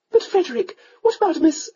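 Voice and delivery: a mother coaxing her son to marry; countess. A narrator's reading.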